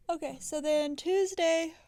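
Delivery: talking mid-yawn. Real, not acted. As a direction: push the words out through a yawn. Tired speaker yawning, then apologizes.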